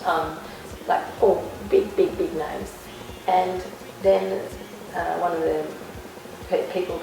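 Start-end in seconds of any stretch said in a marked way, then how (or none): none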